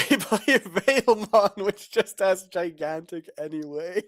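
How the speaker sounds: cracking up mid-sentence; high, loud burst of laughter; laughing through words. Real, not acted. Laughing in disbelief at the play.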